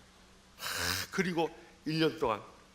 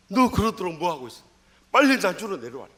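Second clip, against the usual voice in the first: scolding father; 4 semitones higher, 7 dB louder than usual. Retold or imitated. Father scolds son on phone.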